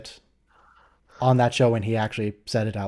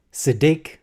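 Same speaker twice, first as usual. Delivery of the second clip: "Siddiq" with the second syllable stressed; 2 semitones higher than usual. Shown as a demonstration. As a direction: say "Siddiq" leaning on the end, si-DIQ. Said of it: "emphasis on the second syllable".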